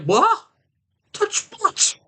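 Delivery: very high, loud startled yelp; mock startled. Man taken aback by partner's request in the car, mock startled. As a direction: shoot up high in alarm.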